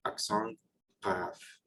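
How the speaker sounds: grave said the French way, with a soft nasal accent; French accent grave, softly. Shown as a demonstration. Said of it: "it's gonna be called accent grave".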